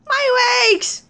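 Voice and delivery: high wail of pretend pain; pained cry. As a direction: cry out over hurt legs. Screaming over crushed legs.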